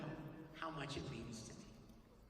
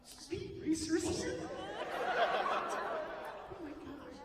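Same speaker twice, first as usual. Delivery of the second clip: high, slow, thrilled squeal; starstruck fan; 10 semitones higher, much slower than usual. Retold or imitated. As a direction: gush like a kid meeting a hero. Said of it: "I'm like".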